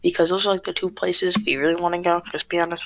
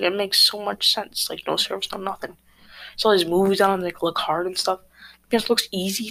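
normal talking voice

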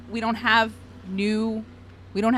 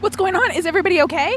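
high, loud worried call; alarmed high voice; 8 semitones higher, 6 dB louder, faster than usual. Acted. Keeper rushes in alarmed.

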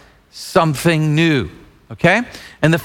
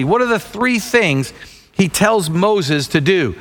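ordinary talk, nothing marked

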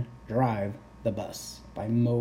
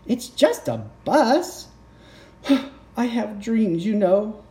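dramatic sighing pigeon; 11 semitones higher, 5 dB louder than usual. Acted.

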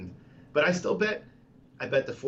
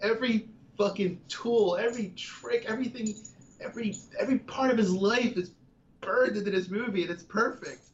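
worked-up, higher passionate outburst; excited rant; 8 semitones higher than usual. Real, not acted. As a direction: gush about it, fired up. Passionate rant about favourite film.

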